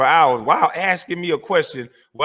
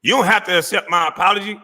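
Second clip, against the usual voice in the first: mocking; 4 semitones higher than usual. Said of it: "after the".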